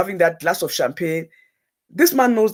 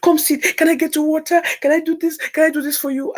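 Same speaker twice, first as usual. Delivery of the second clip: much higher, fussing caring friend; 9 semitones higher than usual. Retold or imitated. Caring bride fussing over her upset friend, much higher. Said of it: "she's that kind of caring person".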